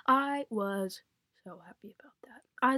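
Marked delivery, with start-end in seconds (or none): 1.4-2.4 breathless whispered gush. Real, not acted. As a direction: whisper it like a secret crush.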